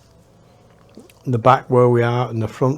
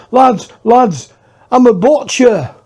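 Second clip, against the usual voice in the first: higher, louder, pleading calm-down; reassuring plea; 9 semitones higher, 6 dB louder than usual. Retold or imitated. Butcher calls out to the scared lads, higher and louder. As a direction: call out to settle the frightened lads. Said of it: "he goes".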